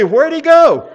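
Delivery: high, loud, laughing mock bewilderment; puzzled wife. Retelling his wife's confusion at the kneeling waiter, high and laughing. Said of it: "and she goes".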